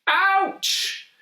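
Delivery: sharp, very high yelp; cry of pain. A narrator's reading. Monster yelping when the parrot tweaks his nose. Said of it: "cried the monster".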